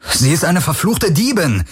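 high-pitched angry accusation; indignant, exasperated outburst; snorting indignation. A narrator's reading. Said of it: "schnaubte Garland".

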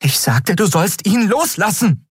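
rising to a high, heated command; insistent order. A narrator's reading. Endriel repeats her order to Keru, insistent. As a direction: repeat it, brooking no refusal.

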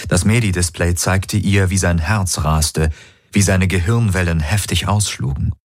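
plain reading voice

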